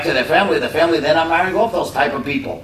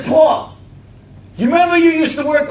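loud confrontation; 6 dB louder than usual. Retold or imitated. Man confronts Paul loudly.

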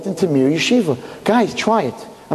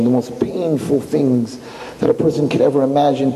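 usual speaking voice

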